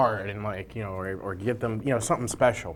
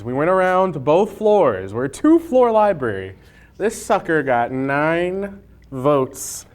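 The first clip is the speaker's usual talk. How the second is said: excited announcement; 6 semitones higher, 5 dB louder, slower than usual. Real, not acted. Announcing the winning sauce.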